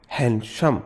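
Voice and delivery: हैंडसम split with a gap in the middle. Shown as a demonstration. Teacher shows students a syllable break in handsome. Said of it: "हैंडसम बीच में gap आया".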